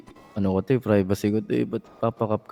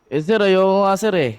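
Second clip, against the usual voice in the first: higher, louder, flaring up at the officer; heated refusal, voice climbing; raised voice; 9 semitones higher, 6 dB louder than usual. Acted. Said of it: "ba't tumataas yung boses mo".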